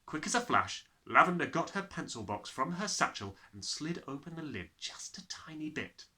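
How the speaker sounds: usual speaking voice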